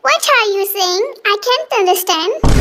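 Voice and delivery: very slow, puzzled English. Acted. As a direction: stretch every English word out. England-raised boy, confused English.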